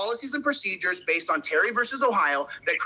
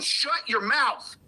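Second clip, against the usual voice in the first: telling them off; 4 semitones higher, much slower than usual. Retold or imitated. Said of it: "I tell them".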